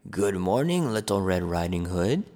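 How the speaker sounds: a low gruff wolf greeting; a hint of a big bad wolf. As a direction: play the wolf low and gruff, sly and friendly. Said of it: "a gruff voice said".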